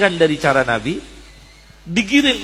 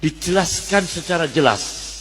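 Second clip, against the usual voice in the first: ordinary talk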